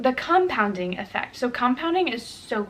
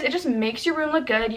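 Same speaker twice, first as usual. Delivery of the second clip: ordinary talk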